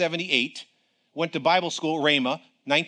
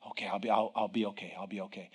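much softer, lower, calming himself; relieved murmur; 8 semitones lower, 10 dB quieter than usual. Retold or imitated. The relieved preacher telling himself, soft and low. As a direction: exhale the fear away. Relief after God's words.

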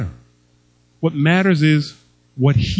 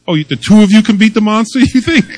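fast, laughing tease, pitched up; 1 teasing through laughter; 6 semitones higher, 6 dB louder, faster than usual. Retold or imitated. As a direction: poke fun at him while laughing. Grandpa teases grandson, laughing.

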